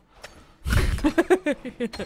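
lautes, hohes Auflachen; lautes Lachen. Real, not acted. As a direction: herzhaft loslachen. Lacht über eigenen Witz. (lautes Lachen)

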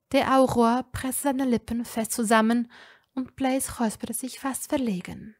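plain reading voice

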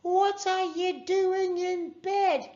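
very high, slow called-out question; high, stretched-out shout; yelling through glass. A narrator's reading. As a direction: holler it through the window. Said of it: "she yelled".

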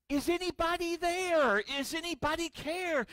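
high anxious lament; fearful cry. Acted. Disciples in the storm crying out in fear.

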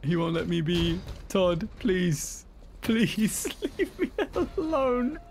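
high laughing whine; laughing mock plea. Real, not acted. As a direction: plead with a giggle. Mock pleading to Todd.

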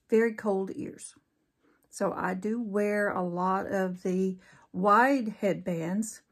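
usual speaking voice

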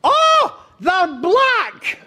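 very high shriek of astonishment; imitated wife's high-pitched shout. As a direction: yell it out shrilly as the amazed wife. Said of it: "she stands up and shouts".

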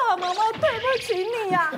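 high, frantic apology to the mother; flustered apology. Retold or imitated. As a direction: blurt sorry in a panic. Students apologize, panicked.

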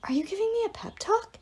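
high, incredulous question; dazed hero. A narrator's reading. Wounded Apollo to the arrow, high dazed disbelief. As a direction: wonder at it from a fog of pain.

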